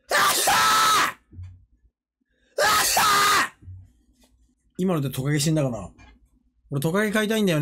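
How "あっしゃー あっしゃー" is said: sudden high-pitched shouted yelp; short, high outburst; imitated explosive cry. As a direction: burst out with a noisy cartoon yell. Shouted cry in the cartoon act.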